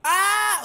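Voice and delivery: high, loud "ah" of alarm; startled scream. Acted. Passenger startled by the storm, screaming. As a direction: yelp as the ship lurches.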